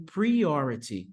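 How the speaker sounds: usual speaking voice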